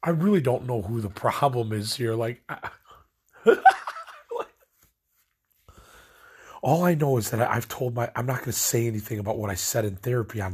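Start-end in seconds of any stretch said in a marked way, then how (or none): 3.4-4.4 a high burst of exasperated laughter; short laugh. Real, not acted. Venting to viewers about parents, a short loud laugh.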